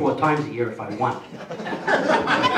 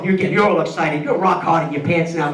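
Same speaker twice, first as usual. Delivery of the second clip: usual speaking voice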